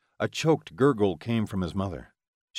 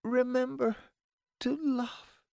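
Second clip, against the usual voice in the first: dying words; 4 dB quieter than usual. A narrator's reading. Dying mother's last words.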